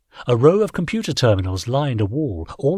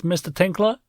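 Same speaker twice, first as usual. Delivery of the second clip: higher, rising detective question; Orin, higher questioning voice; 8 semitones higher than usual. A narrator's reading. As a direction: lift it up like a curious officer. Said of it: "Officer Orin asked".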